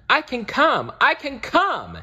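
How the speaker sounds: high, loud cry of joy; excited shout. Acted. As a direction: yell it out, bursting with glee. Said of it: "he shouted".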